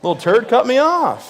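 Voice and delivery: high, loud hammed-up outrage; exaggerated indignant yell; mock angry rant. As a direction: snap back at the parents. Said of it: "I'm like".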